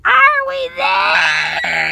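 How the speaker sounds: put-on fed-up kid yelling the question again; whiny yell. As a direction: drag it out in exasperation.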